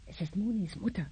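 hushed aside. Acted. Mother whispers aside to husband while on phone, quick hush. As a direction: drop the voice fast for a side remark.

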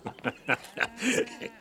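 breathy high rising chuckle; a sly amused laugh. Acted. Farmer amused by the deal.

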